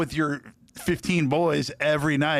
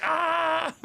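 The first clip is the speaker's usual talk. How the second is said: mock shocked shout. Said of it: "people were like".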